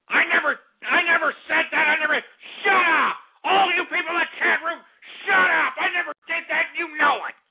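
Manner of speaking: real outrage, yelling at the chat room; furious denial. Real, not acted. Host shouting down listeners over a spliced clip, angry.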